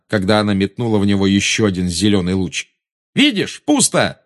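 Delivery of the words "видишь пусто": high taunting shout; Harry taunting Bellatrix. Acted. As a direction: jeer it at her. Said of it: "крикнул он".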